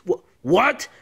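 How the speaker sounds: usual speaking voice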